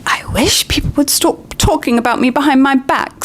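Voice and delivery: tearful breathy whisper; choked with tears. Acted. Myrtle complains through tears, choked whisper. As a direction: voice choked by crying. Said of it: "in a voice choked with tears".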